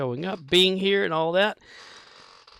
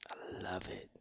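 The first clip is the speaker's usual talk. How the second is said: whispered sarcastic aside; 5 semitones lower, 17 dB quieter than usual. Real, not acted. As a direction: breathe it out dryly. Sarcastic aside about pain.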